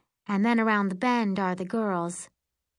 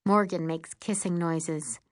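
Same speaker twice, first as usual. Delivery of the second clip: ordinary delivery, nothing marked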